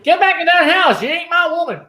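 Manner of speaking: high, loud mock shout; high-pitched re-enacted yell; acted yelling. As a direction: holler it like an irate man ordering someone off. Re-enacting someone yelling at a woman.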